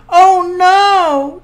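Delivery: startled high cry; gasping. Acted. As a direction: gasp out a shocked oh no. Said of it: "Drift gasped".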